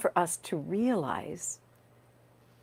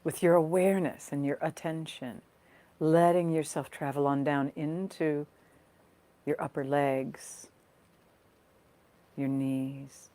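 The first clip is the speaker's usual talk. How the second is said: guided meditation. Real, not acted. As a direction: gently guide listeners into their breath, leaving space between phrases. Leads a guided body scan.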